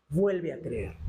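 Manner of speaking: grave y sereno, cayendo al final; invitación tierna. Acted. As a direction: invita como un padre, con calma. Dios invita al hijo. (invitación serena y grave)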